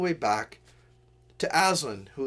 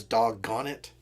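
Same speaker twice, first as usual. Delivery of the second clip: ordinary talk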